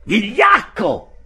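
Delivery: high, loud, slow shout of contempt; furious shout. A narrator's reading. Nafta screams at Settembrini, slow high loud insult. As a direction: hurl the insult. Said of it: "gridò Nafta".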